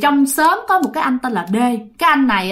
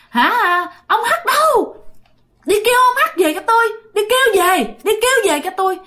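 much higher, piercing possessed voice, faster; shrill ghost voice demanding him; high squeaky woman's voice; 10 semitones higher than usual. Retold or imitated. Possessed man calls for H again, high squeaky woman's voice. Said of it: "cái giọng eo éo các bạn".

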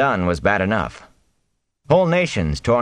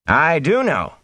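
higher-pitched, studied casualness; too casual; 10 semitones higher, slower than usual. A narrator's reading. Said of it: "too casually".

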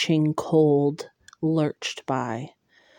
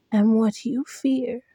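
hollow creature voice; 4 semitones higher than usual. Acted. Monster repeats its threat.

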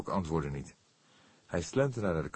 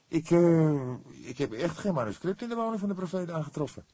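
higher, halting start then rushed; hesitant; 12 semitones higher, 5 dB louder than usual. A narrator's reading. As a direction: stumble in, unsure of himself. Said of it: "sprak hij aarzelend".

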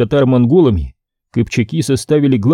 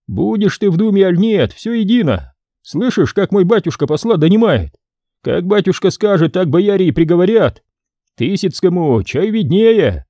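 put-on smug young boyar; 7 semitones higher than usual. Ivan lectures the prince.

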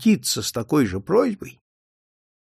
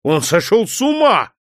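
desperate exclaim, higher; despairing cry; 7 semitones higher, 4 dB louder than usual. Acted. Officer despairing at the priest, exclaiming. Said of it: "с отчаянием в голосе воскликнул".

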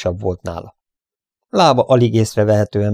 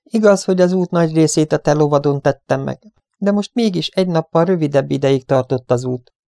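higher, mildly proud delivery; 7 semitones higher than usual. A narrator's reading. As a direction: point it out with some pride. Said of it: "mondta Annie büszkén".

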